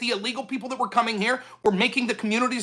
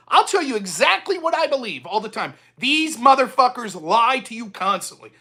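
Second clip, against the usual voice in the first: usual speaking voice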